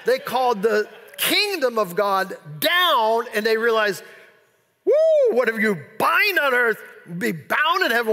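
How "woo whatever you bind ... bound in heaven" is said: excited disciples. Retold or imitated. Disciples return thrilled with power.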